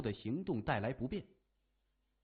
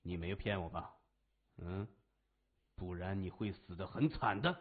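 lower, softer menacing warning; quiet threat; 7 semitones lower, 5 dB quieter than usual. A narrator's reading. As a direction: threaten him without raising the voice. Boss threatens captive.

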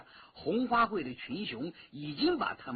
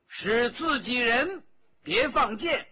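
drawn-out raised call to those below; 5 semitones higher, 5 dB louder, slower than usual. A narrator's reading. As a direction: call out to stop the archers, high and loud. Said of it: "向下喊着". (向下高声喊话)

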